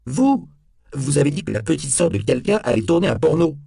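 high, loud retort; outraged, cornered protest; heated accusation. A narrator's reading. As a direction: throw it back at them, pitch shooting up. Suspect throws accusation back at police.

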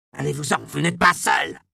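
cri aigu et affolé d'un vieil homme, bien plus haut et plus fort; hurle-le, paniqué et hors de toi; vociférations hystériques. Acted. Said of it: "reprit ses hystériques vociférations". (vociférations hystériques d'un vieillard)

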